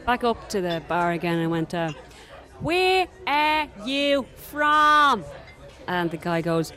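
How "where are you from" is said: put-on very slow, higher, louder Dublin accent; loud and slow for foreigners. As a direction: drag out every word to the barman. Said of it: "speak really loud and really slow".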